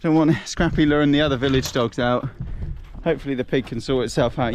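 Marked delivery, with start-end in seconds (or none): none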